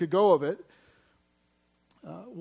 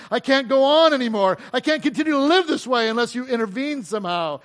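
urgent, raised plea to God; impatient desperation; 4 semitones higher, 4 dB louder, faster than usual. Acted. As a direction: push God to act, pressing harder and louder.

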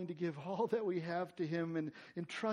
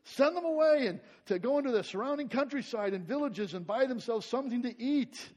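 mock instructing, higher and louder; bossy disciples; 6 semitones higher, 7 dB louder than usual. Disciples telling Jesus what to do, bossy. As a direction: play the disciples ordering Jesus around. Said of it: "disciples kind of instructing Jesus".

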